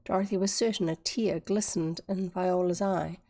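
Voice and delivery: plain reading voice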